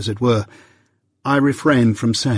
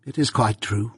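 utter contempt; 4 dB quieter than usual. A narrator's reading. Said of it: "with the indifference of utter contempt".

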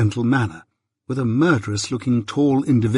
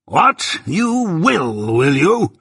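higher and louder, an outraged bluster; 5 semitones higher, 4 dB louder, much slower than usual. A narrator's reading. Jaggers blustering at Mike's admission. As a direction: burst out in outrage. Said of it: "my guardian blustered out".

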